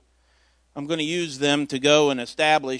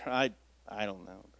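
normal talking voice

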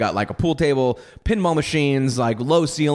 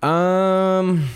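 usual speaking voice

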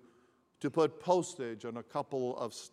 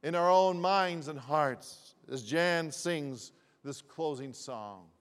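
preaching; 3 semitones higher, 6 dB louder than usual. Acted. Pastor urges the congregation to give thanks, preaching. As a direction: rise up and lift the congregation.

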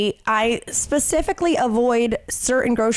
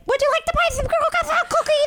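put-on much higher, sweet child's sales pitch; little girl voice. Imitating little Liesel's cookie pitch, high. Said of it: "Lil Liesel be like".

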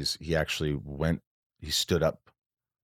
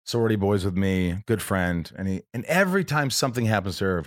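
usual speaking voice

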